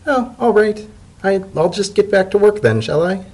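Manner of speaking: high light hesitant voice; Spinning Top meek. Acted. Secretary answering her boss in the chimney, high and meek. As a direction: lift into a high timid agreement.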